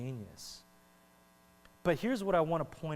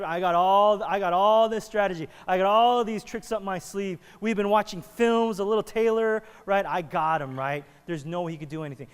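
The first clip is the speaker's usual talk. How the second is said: loud, hyped-up boasting fighter; pumped up; 4 semitones higher, 9 dB louder than usual. Retold or imitated. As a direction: brag like a fighter before the bout. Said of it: "He's all getting pumped up".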